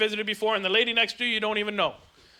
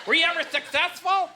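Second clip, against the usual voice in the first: higher-pitched mock questioner; imitated heckler; 6 semitones higher than usual. Heckler asking comic about suicide attempts, imitated higher voice. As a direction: ask it as the nosy heckler, voice pitched up.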